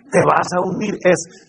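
ordinary talk, nothing marked

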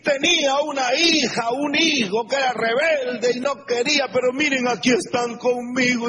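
high, bright and proud telling; a mother showing off her redeemed children; proud testimony. Retold or imitated. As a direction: announce your child's return proudly. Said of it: "Cuando oye decir".

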